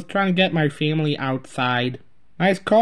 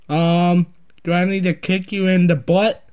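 ordinary talk, nothing marked

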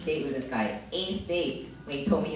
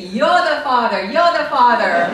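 much higher, loud, gleeful declaration; excited mock announcement; 10 semitones higher, 15 dB louder than usual. She pre-empts the guy, shouting gleefully. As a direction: proudly blurt it out. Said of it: "I said to him".

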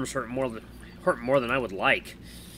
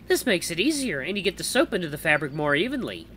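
higher-pitched helpful character voice; female visitor, instructive; 6 semitones higher than usual. Acted. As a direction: show them how in a higher voice. Visitor shows how to wash.

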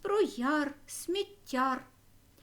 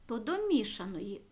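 normal talking voice

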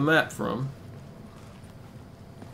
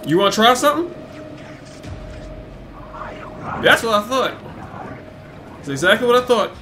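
taunting; 7 semitones higher, faster than usual. Real, not acted. Taunting enemy in game.

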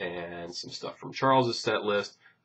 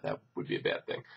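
low unvoiced mutter; whispered aside; 13 semitones lower, 8 dB quieter, faster than usual. Real, not acted. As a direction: drop to a whisper. Muttered aside after radio bit.

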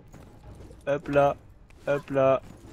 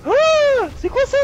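high loud shocked cry; startled shriek; 10 dB louder, slower than usual. Real, not acted. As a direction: yelp at the sudden monster. Startled by big enemy.

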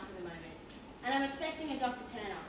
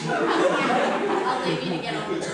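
laughing; 3 semitones lower, 13 dB louder, slower than usual. Acted. Inspector breaks into laughter.

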